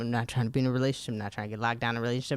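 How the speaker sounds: quick, low retold protest; rattled-off refusal. Telling him no relationship, rattled off low.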